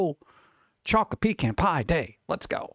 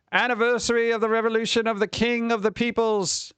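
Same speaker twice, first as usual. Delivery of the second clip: mock grand announcer; 8 semitones higher than usual. Grandly reading a holiday name.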